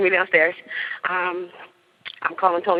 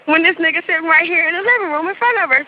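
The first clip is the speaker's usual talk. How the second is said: higher-pitched incredulous quote; imitated disbelief; 9 semitones higher, 3 dB louder than usual. Storyteller voices the sister's disbelief, incredulous. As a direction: mimic her baffled indignation.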